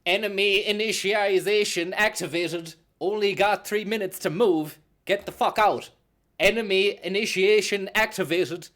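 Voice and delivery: robot voice. Acted. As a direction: shout it like an alarm robot. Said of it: "my new robot voice".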